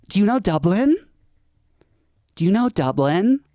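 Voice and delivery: high, rising mock-eager question; mock tourist questioning. Mimics Americans quizzing the Irish.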